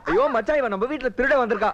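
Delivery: high, urgent outcry; agitated, high-pitched telling on someone; alarmed accusation. Acted. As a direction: raise the alarm about a thief.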